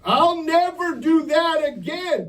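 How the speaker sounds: high, loud, slow mock promise; hammed-up tearful pledge; teen vow. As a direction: imitate a grieving teen swearing off. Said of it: "they'll make all these promises".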